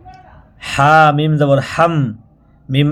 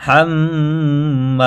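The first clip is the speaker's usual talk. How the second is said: slow recitation; much slower than usual. Shown as a demonstration. Teacher recites হাম্মালাতাল for students, slow and chanted. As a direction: stretch হাম্মালাতাল like a reciter, each syllable long. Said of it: "হাতবি".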